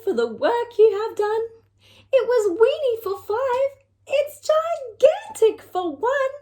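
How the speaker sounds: high, delighted thanks; an old woman thrilled with her house; overjoyed old lady. Acted. Lady thanks the wise old man, high and delighted.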